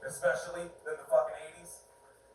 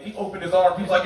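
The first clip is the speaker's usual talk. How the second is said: usual speaking voice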